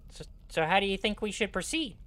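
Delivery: lightly voiced gnome; a touch of gnome squeak; Grupham's gnome voice. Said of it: "this is my gnome voice for Grupham".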